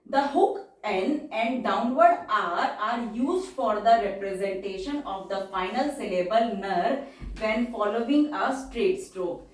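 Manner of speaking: slow, deliberate dictation of the rule; reading the rule slowly. Shown as a demonstration. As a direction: read it out for students to copy. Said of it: "reads rule slowly in English".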